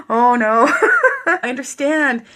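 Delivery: loud, high laugh through the words; retold "oh no" breaking into laughter; laughing dismay. Laughs retelling her reaction.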